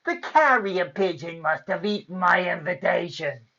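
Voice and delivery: wicked fairy's sugary excuse; mock-sweet with a snarl underneath; snarling sweetly. A narrator's reading. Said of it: "she snarled sweetly".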